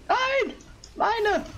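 sudden high yelp of dismay; high-pitched no of frustration; dismayed cry. Real, not acted. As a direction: cry out as the prey slips away. Loses kill in game.

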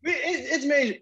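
high, heated comeback; exasperated outburst. Real, not acted. Ranting at gatekeepers, high exasperated outburst. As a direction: blurt it out, fed up.

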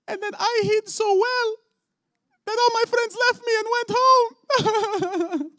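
high, loud mock wail of a child; crying little boy. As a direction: tell it through hammed-up sobs, very high-pitched. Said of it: "And he proceeds to cry".

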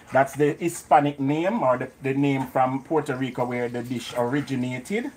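normal talking voice

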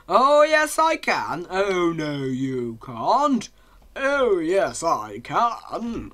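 hammed-up panto call and response; pantomime back-and-forth. Player mimicking yesterday's pantomime, hammed-up panto voices. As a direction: trade drawn-out stage taunts with yourself.

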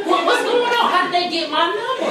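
high-pitched comic alarm; hammed-up baffled questions; mock bewilderment. Preacher finding a girl on the line, mock bewilderment. Retold confusion at surprise three-way call.

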